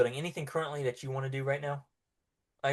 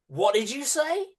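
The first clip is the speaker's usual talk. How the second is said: guard's demand; 10 semitones higher, 4 dB louder than usual. Acted. Guard demands prisoner repeat.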